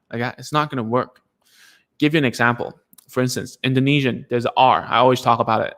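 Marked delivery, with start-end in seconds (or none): none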